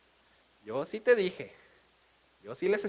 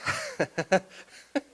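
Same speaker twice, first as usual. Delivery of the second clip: high laugh; 10 semitones higher than usual. Real, not acted. Laughs at own joke.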